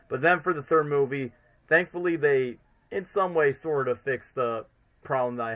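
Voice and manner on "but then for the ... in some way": normal talking voice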